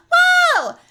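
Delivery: very high, loud squealing laugh and wow. Acted. After hyper voice bit.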